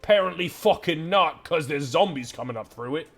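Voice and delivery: exasperated outburst. Acted. Keeper outburst.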